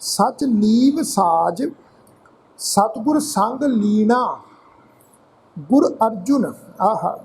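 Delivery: slow, drawn-out chanted reading of the verse; slow scripture recitation. Acted. Teacher reciting scripture to the congregation, slow and chanted. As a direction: intone each line slowly like a hymn.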